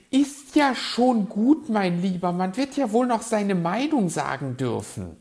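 high, loud, huffy protest; put-on high voice, miffed; grousing magpie. A narrator's reading. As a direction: grumble back in a piping voice. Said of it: "murrte die Elster".

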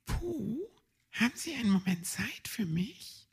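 high, soft whisper; secretive whisper. Acted. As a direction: whisper mysteriously to Puh. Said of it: "flüsterte Frau Igel geheimnisvoll".

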